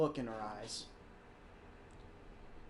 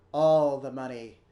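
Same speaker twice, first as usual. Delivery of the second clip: loud, greedy cry for cash; greedy shout; 8 dB louder than usual. Pretend. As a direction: belt it out like a money-hungry miser. Greedy outburst about the job.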